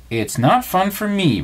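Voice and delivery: high, slow, mildly cross protest; cross insistence. A narrator's reading. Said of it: "Rabbit insisted crossly".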